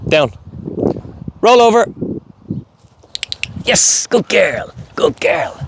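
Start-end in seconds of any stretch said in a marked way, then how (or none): 1.4-5.5 high, slow drawn praise; command and praise. Real, not acted.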